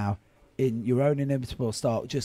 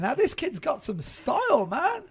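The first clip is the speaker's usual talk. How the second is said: excited; 10 semitones higher than usual. Real, not acted. Thrilled by the movie quote.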